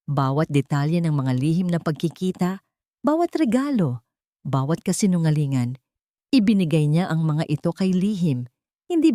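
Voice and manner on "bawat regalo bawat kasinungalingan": ordinary delivery, nothing marked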